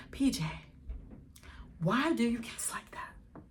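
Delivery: breathy hushed ask; whispered question. Retold or imitated. Quietly asking her boyfriend about his kissing, whispered. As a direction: whisper it close.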